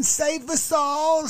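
high, slow mocking plea of an older lady; mock pleading plea. Older lady begging viewers to be saved, high slow mock plea. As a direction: drag out a pleading "Save us all" up high. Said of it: "She's like".

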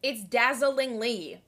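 "dazzlingly" slowly, with the L after the zz; corrected pronunciation. Shown as a demonstration. Co-host correcting her friend, "dazzlingly" slow with both Ls. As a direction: sound out every syllable of "dazzlingly", L included. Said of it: "it's not dazzingly".

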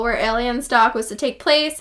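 normal talking voice

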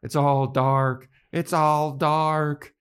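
slow, high, drawn-out lament; mock crying wail. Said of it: "Tara starts crying that it's all dark".

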